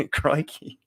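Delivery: badly faked Australian exclamation; mock Australian. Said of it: "I told you I'm bad at this".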